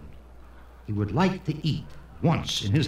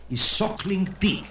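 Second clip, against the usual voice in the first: higher pitch, falling firmly at the end; waiter's announcement; 6 semitones higher than usual. Acted. Waiter announces the most non-kosher dish, proudly definite. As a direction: present the dish as a grand answer. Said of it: "And the waiter says".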